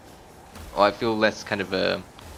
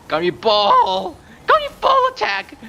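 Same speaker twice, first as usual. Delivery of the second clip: drawn-out loud battle cry climbing to a shriek; screaming; 7 dB louder than usual. Real, not acted. Player launches Gan Yu's attack, screaming. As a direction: scream the attack name, higher each time. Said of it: "Sorry for screaming".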